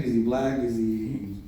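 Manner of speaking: normal talking voice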